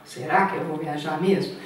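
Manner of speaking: normal talking voice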